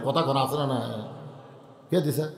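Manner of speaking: normal talking voice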